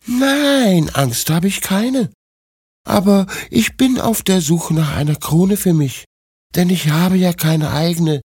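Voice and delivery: very high princess voice; confident young princess. Acted. Princess tells old woman of her crown search, high confident voice. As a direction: play a princess, pitched far up.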